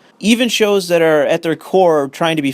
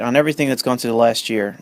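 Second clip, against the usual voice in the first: ordinary talk, nothing marked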